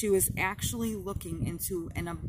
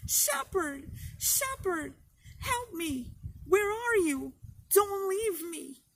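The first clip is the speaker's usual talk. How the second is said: high, desperate calling out, much higher; terrified shriek; 12 semitones higher, slower than usual. A narrator's reading. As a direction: beg him not to leave, in panic. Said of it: "Much Afraid shrieked".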